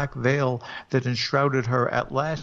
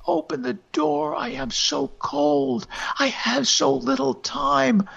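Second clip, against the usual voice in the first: ghostly plea; 13 semitones higher than usual. Acted. Dead wife begs husband to open the door, high ghostly plea. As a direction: beg to be let in from the cold as a spirit. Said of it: "cries the voice".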